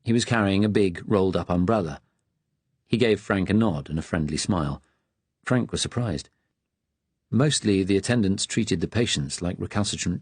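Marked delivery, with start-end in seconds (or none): none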